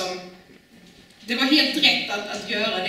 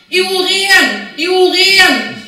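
loud, high drawn-out shout of warning; crying out; 8 semitones higher, 11 dB louder, much slower than usual. Acted. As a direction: call it out at full volume, like an outcast warning passers-by. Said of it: "skylа sitt skägg och ropa".

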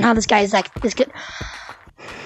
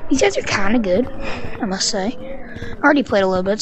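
normal talking voice

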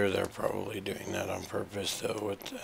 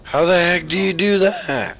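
high, loud whiny outburst; 10 semitones higher, 11 dB louder than usual. Real, not acted. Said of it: "I sound like a whiny teenager".

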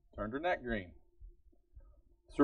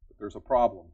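louder, punching the word problem; emphatic declaration; 3 semitones lower, 8 dB louder than usual. Real, not acted. Preacher warning the church, loud and emphatic. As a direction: announce problem to the congregation.

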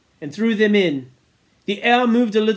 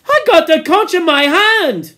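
insistent; 11 semitones higher, 9 dB louder than usual. Acted. Piggy insisting in quarrel.

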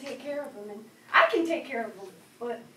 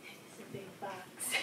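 very soft, slow, almost to herself; hushed wonder; 7 semitones lower, 19 dB quieter, slower than usual. Acted. Child muses on grandpa's box, hushed. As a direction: breathe it out in quiet awe.

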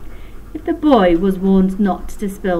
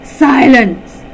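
loud, high command; thundering shout; 5 semitones higher, 10 dB louder than usual. A narrator's reading. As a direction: roar it at the giggling class. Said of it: "thundered Dread".

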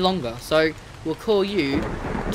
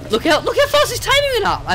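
excited squeal; 5 dB louder than usual. Real, not acted. Taming progress jumps fast.

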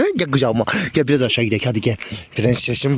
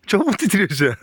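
usual speaking voice

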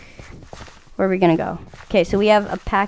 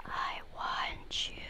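slow eerie whisper; creepy whisper; 26 dB quieter, much slower than usual. Pretend. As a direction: breathe it like a stalker. Creepy whisper to scare friend.

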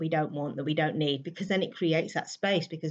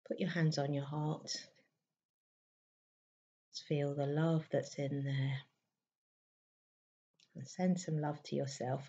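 guided meditation; 4 semitones higher than usual. Real, not acted. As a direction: gently guide them inward, leaving space between lines.

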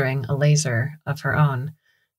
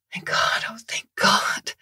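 breathy whisper close to tears; near-sobbing relieved whisper; tearful relief; 4 semitones higher, 4 dB quieter than usual. Acted. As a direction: whisper your thanks, almost crying. Relief after rescue.

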